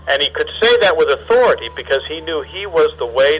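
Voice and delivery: usual speaking voice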